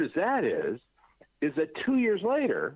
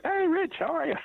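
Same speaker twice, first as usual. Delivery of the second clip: much higher, bright delighted hello; cheery greeting. Retold or imitated. Friends in the vision welcoming Rich, excited high greeting. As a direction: greet an old friend with glee.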